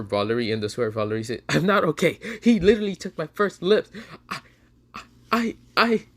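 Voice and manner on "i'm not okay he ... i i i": high, loud, stammering protest; distressed outburst. Acted. Valerie upset over her stolen first kiss, distressed outburst. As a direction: blurt it higher, then stutter on "I".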